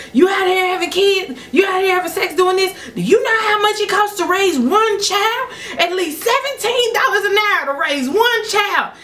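high-pitched, ranting lecture; dad hollering a scolding, acted out; imitated yelling. Upset dad lecturing pregnant daughter, yelling. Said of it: "He's still yelling".

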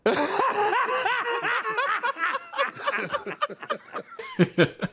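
long squealing giggle; high giggling laugh. Retold or imitated. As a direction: giggle like you can't stop. Long giggle fit retold.

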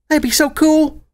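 put-on high eager little girl voice; excited filly voice. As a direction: gush like a thrilled young filly. Filly Serenity delighted.

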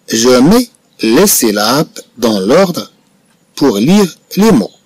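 very slow, syllable by syllable; slow dictation. Shown as a demonstration. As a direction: read slowly for the pupils to follow.

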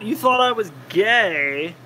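ordinary delivery

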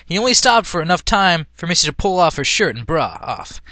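usual speaking voice

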